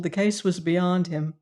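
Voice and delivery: ordinary delivery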